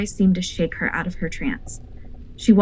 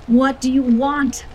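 slow, higher, louder pleading; begging; 7 semitones higher, 6 dB louder, slower than usual. A narrator's reading. Lupita begs the weeping spirit outside the door, pleading. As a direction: beg the thing outside for an answer. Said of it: "Lupita begged".

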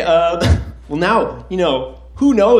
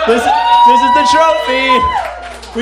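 much higher, louder, stretched out grand reveal; hammed-up announcement; 7 dB louder, much slower than usual. Real, not acted. Host unveiling a fork as trophy, hammed-up shout. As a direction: proclaim the fork like a grand prize.

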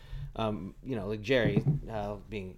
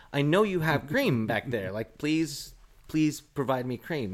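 slow, louder begging, pitched up; pleading; 4 semitones higher, 5 dB louder, slower than usual. Retold or imitated. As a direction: beg for cream slowly and loudly. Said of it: "I was like".

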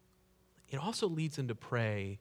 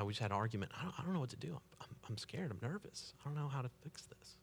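much softer, nervous confession; scared confiding; 12 dB quieter, faster than usual. Acted. Friend softly confiding marriage trouble over the phone. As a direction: fade into a worried near-murmur.

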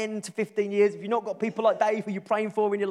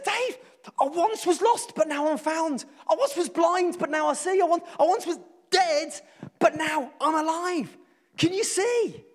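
higher, impassioned preaching to a friend; fervent plea; 10 semitones higher, 3 dB louder than usual. Retold or imitated. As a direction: pour your heart into every line. Passionately explaining the hymn to Dave.

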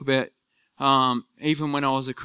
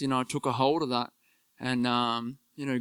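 normal talking voice

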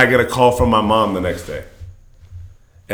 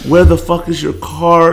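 mom crying; 6 semitones higher, slower than usual. Retold or imitated. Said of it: "crying and shit".

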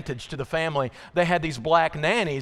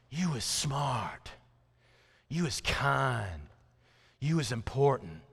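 put-on soft, slow child's recital; hushed, understated little girl; little girl reciting alone; 3 semitones lower, 6 dB quieter, slower than usual. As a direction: say it quietly and slowly, line by line. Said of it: "And without help from the nanny".

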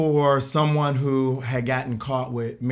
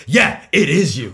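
slow, louder insistent cop retort; angry cop; 2 semitones higher, 6 dB louder than usual. Retold or imitated. Cop insisting the warrant is hers, slow angry retort. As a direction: bark it back like an irritated cop. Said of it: "he got even angry".

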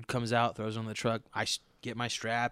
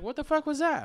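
much higher, stunned; baffled. Retold or imitated. Back in the truck, baffled at the neighbor, high squeak. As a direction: squeak out disbelief. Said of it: "I was like".

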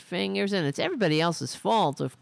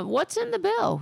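imagined reporter question. Retold or imitated. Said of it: "nobody says to the".

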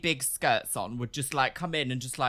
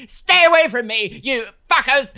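loud, high indignant yelling; shouting; 8 semitones higher, 9 dB louder than usual. Acted. Shoplifter warning off the crowd around her, shouting. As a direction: yell to warn the crowd off. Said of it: "she began shouting".

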